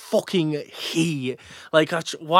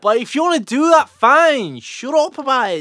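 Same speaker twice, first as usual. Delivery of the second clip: high, loud, fed-up rant; exasperated outburst; 11 semitones higher, 4 dB louder than usual. Real, not acted. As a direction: snap at them with real irritation. Fed up with gym bores.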